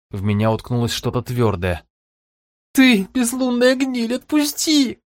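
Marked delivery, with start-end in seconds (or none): 2.7-5.0 high, slow, choked with tears; tearful roar. Acted. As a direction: roar it through sobs. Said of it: "сквозь слёзы проревел Хромой".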